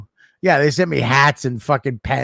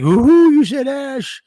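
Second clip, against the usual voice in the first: higher, louder, slow mock kid taunt; gleeful kid impression; 11 semitones higher, 6 dB louder, slower than usual. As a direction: tease like a giggling little boy. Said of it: "And he's like".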